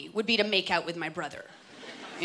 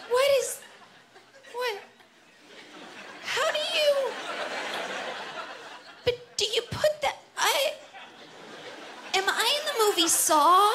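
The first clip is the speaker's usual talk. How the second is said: very high, squeaky bewildered voice; baffled squeak; 13 semitones higher than usual. Retold or imitated. Baffled self in a sex store, squeaking very high. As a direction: squeak in total confusion, laughing. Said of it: "I'm like".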